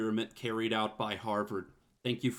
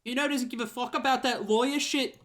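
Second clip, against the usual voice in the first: Kuno with an Australian twang; 12 semitones higher than usual. Acted. Player voicing Kuno to the detective, high Australian-sounding kid voice. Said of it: "Why are you making them Australian?".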